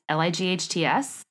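ordinary talk

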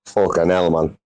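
ordinary talk

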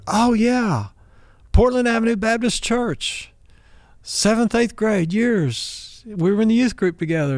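light up as it finally clicks; sudden recognition. Retold or imitated. To the old youth-group friend, remembering her with excitement.

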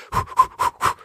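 sharp, rhythmic voiceless puffs; psyching-up breaths. Shown as a demonstration. Bracing to face an angry customer, sharp huffing breaths. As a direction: huff hard to pump yourself up. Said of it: "a couple of crazy breaths".

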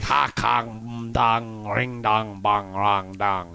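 low, soft, droning nonsense syllables; mock alien language. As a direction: intone it like a solemn alien greeting. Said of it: "went up there and said".

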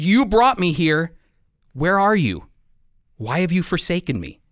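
normal talking voice

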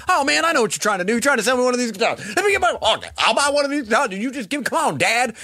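high, fast-talking hustler impression; Floyd Burney. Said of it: "he's all fast talking".

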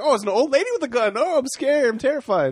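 high, hammed-up sarcastic fright; mock fear. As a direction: pretend to be terrified, clearly joking. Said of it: "Like, sarcastically".

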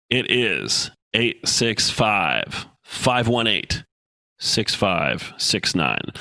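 very slow, each digit spaced out; slow dictation. Shown as a demonstration. Host dictating his call-in number slowly to listeners. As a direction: read the number slowly so they can write it down. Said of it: "if you're ready to write it down, here we go".

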